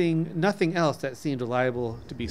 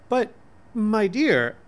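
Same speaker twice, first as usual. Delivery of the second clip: disappointed; 8 semitones higher, 6 dB louder, much slower than usual. A narrator's reading. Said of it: "I answered in a disappointed tone".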